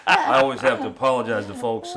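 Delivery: loud laughing apology. Real, not acted. Laughing at own mispronunciation.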